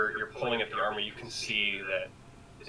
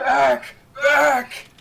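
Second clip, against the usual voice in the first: loud panicked yell; berserk rage; 15 dB louder than usual. Acted. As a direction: bellow it, high and frantic. Thrashing survivor yells at rescuers.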